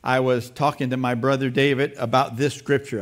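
ordinary delivery

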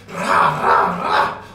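low scornful ra ra ra; mocking low grunts. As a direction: grunt it like mindless chanting. Mocking noise at opponents. (gruñido burlón y grave)

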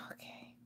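breathy exasperated sigh then okay; weary sigh. Real, not acted. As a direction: sigh it out, fed up. Losing patience with tangle.

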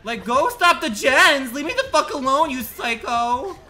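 high, loud, laughing shriek while chased; chased by the killer, yelling and laughing; panicked yelling. Real, not acted. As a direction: scream it at the killer, half laughing.

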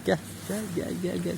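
quiet, high-pitched calling of the kittens; gentle kitty call; soft coaxing call. Real, not acted. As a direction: coax them over under your breath. Calling kittens over softly.

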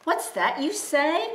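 high, startled cry of dread; donkey alarmed at being chosen. Acted. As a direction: gasp it out in alarm. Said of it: "cried the donkey with dread".

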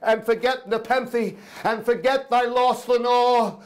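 high, feverish outpouring; impassioned crying out. Acted. Narrator cries at the raven to forget Lenore, high and fervent. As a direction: press on in a high, desperate cry. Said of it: "I cried".

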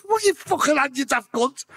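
high-pitched retold outburst; exasperated yell. Working on his mate, exasperated high retort to Dave. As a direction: snap back, high and exasperated. Yelling at helper during CPR.

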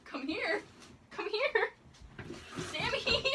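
high, coaxing call, a touch panicked. Real, not acted. Owner calling her escaped lizard, high coaxing pleas. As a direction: coax the lizard back, voice climbing high.

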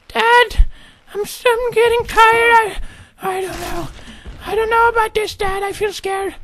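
put-on very high, hesitant child voice; scared little son. Son confessing fear to Dad, high and hesitant. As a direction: falter in a squeaky kid's falsetto.

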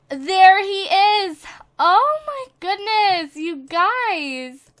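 slow, high and loud, overjoyed at the sight; thrilled. Real, not acted. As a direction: gasp with delight at the ghost horse. Spots the ghost horse.

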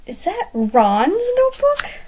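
high, drawn-out astonished question; slow, high-pitched story gasp. A narrator's reading. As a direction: ask in amazement, pitch leaping up. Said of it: "Kashim gasped".

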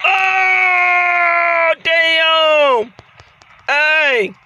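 high, long drawn-out howl; stretched-out yell of dismay; pained outcry. Real, not acted. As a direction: wail in shock at taking a big hit. His wrestler gets hit.

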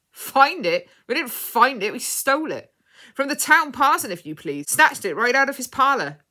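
loud, high, crowing pirate voice; booming pirate brag with big pitch swoops; boastful pirate. A narrator's reading. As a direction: crow proudly about the theft. Pirate boasts of stealing the bird.

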